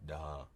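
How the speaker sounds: low "Duh" for Texan "down"; imitated missing N. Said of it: "the N on the end of that".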